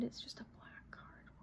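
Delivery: hushed, breathless whisper. Real, not acted. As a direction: whisper it in quiet awe. In awe of a tarot card.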